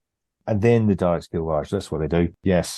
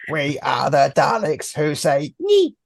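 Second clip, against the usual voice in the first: Dalek voice; 8 semitones higher, 3 dB louder, much slower than usual. Acted. Dalek mashed with Python knights.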